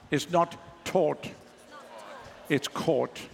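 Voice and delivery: South African rounded "aw" in "taught" and "caught", drawn out; South African accent. Shown as a demonstration. Preacher corrects the congregation's repetition, South African accent. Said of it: "Say it in a proper South African accent".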